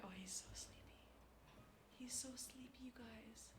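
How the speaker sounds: hushed whisper so the cat can sleep. Real, not acted. Streamer whispering to chat while her cat dozes. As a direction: whisper so as not to wake him.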